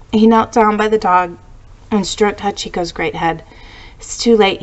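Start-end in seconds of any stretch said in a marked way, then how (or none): none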